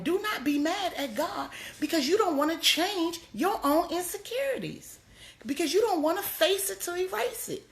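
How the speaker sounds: high, driving sermon cadence; preaching at full force. Real, not acted. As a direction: build the congregation up. Sermon climax.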